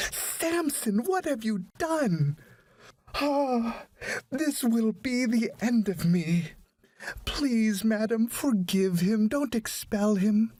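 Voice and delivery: high, distraught plea from a mother; shocked, pleading mother. A narrator's reading. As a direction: beg in high-pitched panic for her son. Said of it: "exclaimed in shock".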